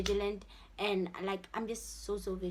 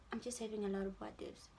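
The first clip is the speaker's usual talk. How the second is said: normal talking voice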